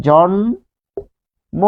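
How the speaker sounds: "জন্ম" drawn out as jon... mo; syllable by syllable. Shown as a demonstration. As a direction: sound out "জন্ম" very slowly, pausing before mo. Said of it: "পরিবর্তন হয়ে গিয়েছে".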